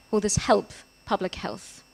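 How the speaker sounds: ordinary talk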